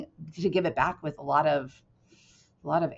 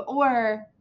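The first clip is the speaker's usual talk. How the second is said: ordinary talk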